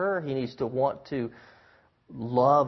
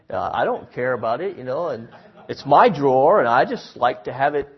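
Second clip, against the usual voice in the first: dismissive husband; 8 dB louder than usual. Retold or imitated. Husband's side acted out.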